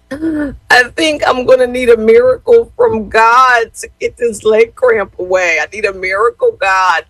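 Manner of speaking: hammed-up sobbing prayer; imitated crying child. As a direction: wail it like a hurting child begging for a miracle. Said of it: "He was crying so hard".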